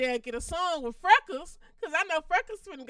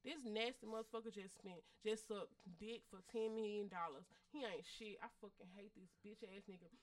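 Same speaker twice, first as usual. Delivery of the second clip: much softer, lower, under the breath; quiet grumble; 5 semitones lower, 19 dB quieter than usual. Pretend. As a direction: mutter it to yourself in private. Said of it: "Or I can say".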